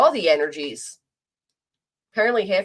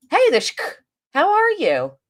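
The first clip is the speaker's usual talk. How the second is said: high, bright hello to a friend; excited greeting; 9 semitones higher, 3 dB louder than usual. Real, not acted. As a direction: perk up and greet them. Sudden greeting to newcomer.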